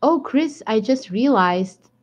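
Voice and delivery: ordinary talk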